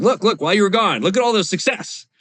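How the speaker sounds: lightly voiced proud coworker showing off his numbers; boastful colleague. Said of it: "He's like".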